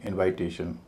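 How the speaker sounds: "इनवाइटेशन" keeping the full "वाइट" sound of invite; naive pronunciation. Shown as a demonstration. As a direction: say "इनवाइटेशन" as invite plus -ation. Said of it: "उच्चारण कुछ इस तरह होगा". ("इनवाइटेशन" में "वाइट" का पूरा उच्चारण)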